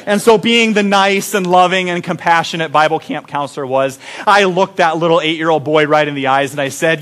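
ordinary talk, nothing marked

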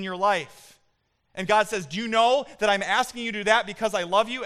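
loud, high, fervent sermon delivery; preaching at full force. Acted. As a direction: preach it out to the whole church.

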